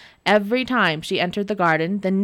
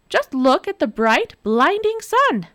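teasingly innocent; 8 semitones higher, 4 dB louder than usual. Acted. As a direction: tease him innocently. Nora teasing.

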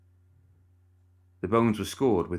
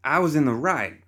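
a high, loud shout; 8 semitones higher, 4 dB louder than usual. Acted. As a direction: yell it back at your father. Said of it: "Bernadette shouted".

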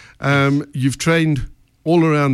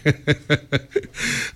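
laughing; 3 semitones higher, 4 dB quieter than usual. Real, not acted. Host laughs at own joke.

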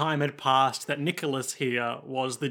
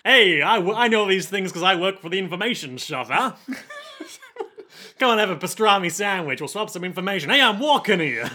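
put-on loud, strained New York accent with laughs; hammed-up New Yorker bravado, as in Brooklyn; bad New York accent, breaking into laughter; 5 semitones higher, 5 dB louder than usual. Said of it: "as soon as you started doing the accent".

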